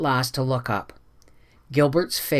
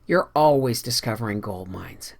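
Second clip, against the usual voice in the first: plain reading voice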